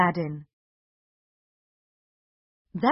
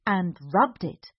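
usual speaking voice

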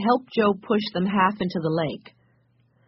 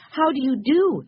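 high-pitched, courteous little greeting; polite greeting; 8 semitones higher, 3 dB louder than usual. A narrator's reading. Benny meeting the storekeeper, polite and high-pitched. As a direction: greet him politely in a higher voice. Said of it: "said Benny politely".

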